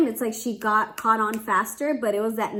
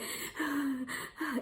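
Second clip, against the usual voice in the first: imitated baby's long contented sigh, higher and much softer; 4 semitones higher, 11 dB quieter than usual. Mom imitating her baby relaxing in dad's arms, soft sigh. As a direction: breathe out like a settled infant.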